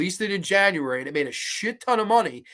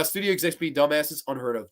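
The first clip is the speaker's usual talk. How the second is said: ordinary delivery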